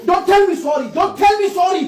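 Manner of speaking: furious refusal, shouted; angry shout. Acted. Husband refusing his cheating wife's apology, angry shout. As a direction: yell it back at her in fury.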